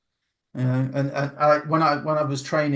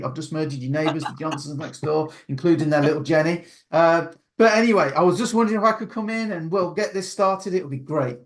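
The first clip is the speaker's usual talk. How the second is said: energetic salesman pitch; 4 semitones higher than usual. Retold or imitated. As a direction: sell it with bouncy over-the-top energy, like a grinning door-to-door rep. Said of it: "which guy do you prefer".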